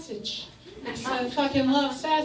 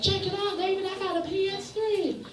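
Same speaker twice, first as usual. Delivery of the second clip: bragging buddy voice; 9 semitones higher, 4 dB quieter than usual. Retold or imitated.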